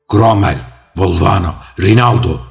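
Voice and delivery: loud, high cry of shock; astonished shout. A narrator's reading. Adviser Publius shouting names over the corpses, astonished. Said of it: "diye şaşkınlıkla bağırdı".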